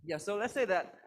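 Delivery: ordinary delivery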